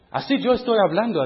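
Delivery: usual speaking voice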